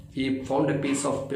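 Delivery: ordinary talk, nothing marked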